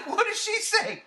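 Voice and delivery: usual speaking voice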